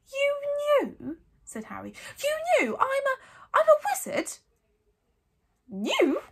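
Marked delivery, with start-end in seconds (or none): 0.1-1.2 put-on high, loud disbelieving boy; shocked. A narrator's reading. Harry confronting his aunt and uncle, shocked. Harry learns relatives knew. 2.2-4.4 put-on high, loud incredulous boy; astonished. A narrator's reading. Harry learning he is a wizard, astonished. 5.7-6.2 high, loud shriek; Aunt Petunia shrieking. A narrator's reading. Aunt answering Harry's accusation, shrieking. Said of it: "shrieked Aunt Petunia".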